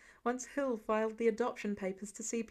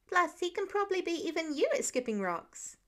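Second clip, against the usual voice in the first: put-on louder child; 6 semitones higher than usual. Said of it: "Nate's voice grew in volume".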